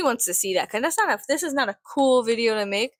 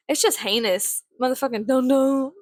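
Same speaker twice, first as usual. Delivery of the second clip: ordinary talk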